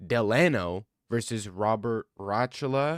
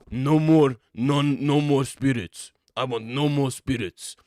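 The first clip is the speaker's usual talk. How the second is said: loud, slow accented mock plea; hammed-up accented impression; imitating Yuri; 2 semitones higher, 7 dB louder, slower than usual. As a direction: play the fighter begging to be free of spirits. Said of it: "Yuri was talking about".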